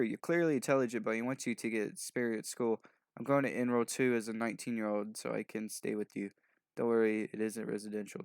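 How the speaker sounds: ordinary talk, nothing marked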